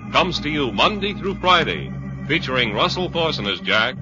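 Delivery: ordinary talk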